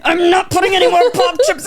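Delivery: high mock protest; exasperated refusal. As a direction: imitate a friend who has had enough.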